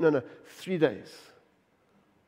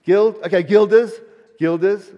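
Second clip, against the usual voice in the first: louder, rising call to the room; 3 semitones higher, 9 dB louder than usual. Real, not acted. Preacher calls out to the congregation, louder and rising. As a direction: call it out to the back rows.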